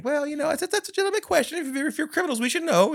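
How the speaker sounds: a high put-on voice for the girlfriend's father; mock father voice. Retelling a TV scene, a father's voice high and mock-innocent. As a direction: play the dad, high and prim, treating it as a fair question. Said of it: "the father comes out and he goes".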